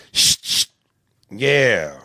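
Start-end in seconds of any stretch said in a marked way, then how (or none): 0.1-0.6 shushing. Acted.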